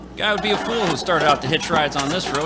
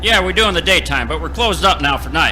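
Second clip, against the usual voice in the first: loud, irritated shout through the door; annoyed yell; 8 dB louder than usual. Acted. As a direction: bark it at the late visitor. Owner refusing late customer.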